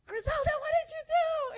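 high, loud scolding shriek; panicked yell. Retold or imitated. Relative yells to Griselda over the beeping, high panicked shout.

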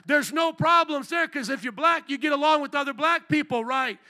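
loud, high preaching voice; preacher re-enacting preaching on the west side; street preaching.

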